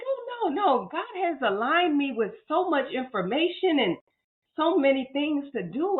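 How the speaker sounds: desperate plea. Retold or imitated. Retold desperate inner plea.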